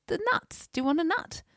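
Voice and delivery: high-pitched imitation of mum; mum explaining. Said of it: "She goes".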